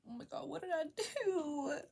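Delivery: high-pitched, dramatised alarm; hammed-up worried cry; mock panic. Retold panic at mom's news.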